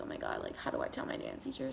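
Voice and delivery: hushed, quick worried whisper; anxious whisper. Retold or imitated. Speaker dreads telling her dance teacher, hushed whisper. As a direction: fret under your breath.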